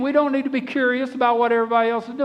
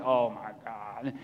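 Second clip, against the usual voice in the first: put-on much lower, slow, groaning old dog; tired old dog; 12 semitones lower, 9 dB quieter, slower than usual. The old dog left behind by the walker, groaning low and slow. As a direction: sigh it out like an arthritic old dog. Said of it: "and he's like".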